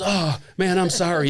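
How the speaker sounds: high, urgent mock apology; flustered apology. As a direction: imitate a startled friend apologizing fast after a blunder. Acting friend's flustered apology.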